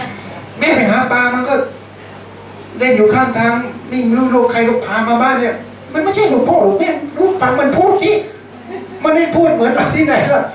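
mock the boy's strange accent; mocking taunt. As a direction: siblings mocking his different speech. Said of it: "อีกคนหนึ่งก็บอก".

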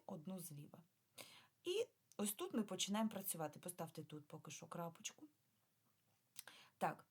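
ordinary delivery

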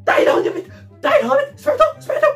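high-pitched panicked cries; hysterical fear. Acted. Woman insisting to Obada that something moved, hysterical fear. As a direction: shriek in mounting terror. Panics over voice and moving object.

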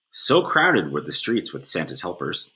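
ordinary delivery, nothing marked

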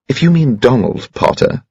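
mild bristling challenge; dangerously. A narrator's reading. Daughter defends her friend to stepfather, mildly dangerous. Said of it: "said Bridget dangerously".

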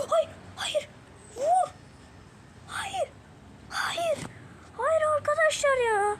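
çok tiz ve yüksek sesle hayır diye bağır; kaçan gole içten çığlık; umutsuz feryat. Real, not acted. (seyircilere kaçan golü anlatırken tiz feryat)

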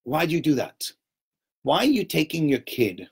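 normal talking voice

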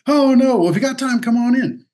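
a flustered, overly chipper invitation; sheepish coach. Retold or imitated. Coach inviting them in after the blunder, mock chipper.

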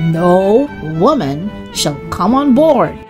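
usual speaking voice

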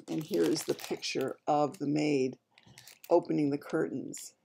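usual speaking voice

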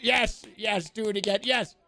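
high, hammed-up frenzied cries; mock frenzy. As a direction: egg them on like a crazed viewer. Acts out triggered viewer reacting.

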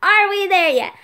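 fed-up backseat yell; exasperated demand. Acted. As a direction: blurt it out, falling at the end. Building road-trip pestering to a peak.

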